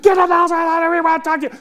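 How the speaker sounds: dad ordering his daughter inside, angry and loud; angry dad command. Retold or imitated. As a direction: snap it like a furious dad.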